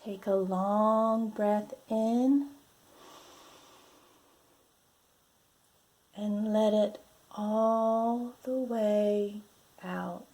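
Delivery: lively, drawn-out picture-book voice; reading to children. Acted. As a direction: read it like a storybook for little ones.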